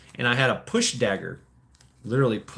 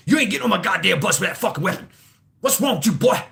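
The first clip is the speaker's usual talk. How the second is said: imitating the driver yelling, higher, louder and fast; angry bus driver; 7 semitones higher, 4 dB louder, faster than usual. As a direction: bark it like the furious old driver. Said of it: "And he's like".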